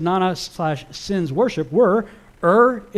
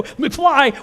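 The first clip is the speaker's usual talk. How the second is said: high, louder mocking McFly with a falling end; 9 semitones higher, 6 dB louder than usual. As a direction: toss off McFly like a taunt. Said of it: "that's my elaboration".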